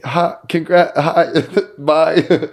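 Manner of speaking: high, loud, stumbling greeting; mock-flustered squeak with a laugh; imitating starstruck fluster. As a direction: act out someone freezing up, words tumbling out high. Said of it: "she's frozen. She's like".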